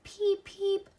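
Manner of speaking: high, loud chirping peep; baby chick peeps. A narrator's reading. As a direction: squeak it like a tiny chick inside the egg. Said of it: "answered the egg".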